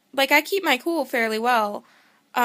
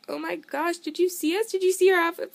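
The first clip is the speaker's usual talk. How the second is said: much higher, rising, eager questions; excited fan gushing; 8 semitones higher than usual. Retold or imitated. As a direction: spill it out like a thrilled fan. Fans gushing at [name]'s mom.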